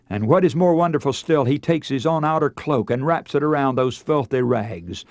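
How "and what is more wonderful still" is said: ordinary talk, nothing marked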